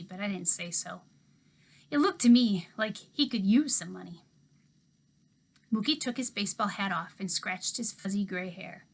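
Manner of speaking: usual speaking voice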